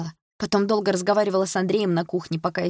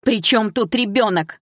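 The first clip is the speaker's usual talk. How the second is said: louder, higher, slow exclamation; exclaiming; 5 semitones higher, 5 dB louder, slower than usual. A narrator's reading. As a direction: exclaim it, glaring. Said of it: "воскликнула я". (дочь восклицает, глядя на отчима)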